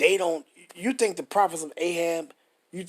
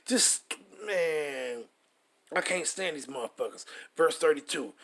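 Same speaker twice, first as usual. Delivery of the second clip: normal talking voice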